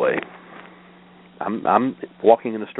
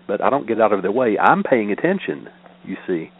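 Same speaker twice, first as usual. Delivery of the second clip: ordinary talk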